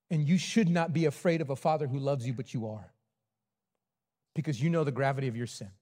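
a hint of quiet sermon intimacy; intimate preaching. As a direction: draw the room in close and gentle.

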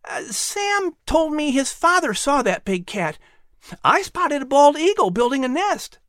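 put-on high, bright young boy's voice; a keen son offering to help; boy asking his mother eagerly; eager boy Patrick.

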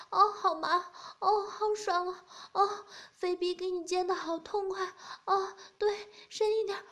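high, loud moans and cries; woman character moaning loudly. Acted. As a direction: cry out high and loud, lost in pleasure.

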